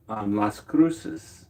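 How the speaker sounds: Las Cruces said slowly with a Spanish lilt, kʰɹusɪz; Spanish pronunciation. Shown as a demonstration. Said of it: "Notice I put that accent on there".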